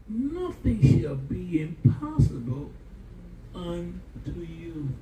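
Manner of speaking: slow, weighty scripture reading; scripture quote. Real, not acted. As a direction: draw each word out like a verse from the pulpit. Pastor quoting scripture, slowed.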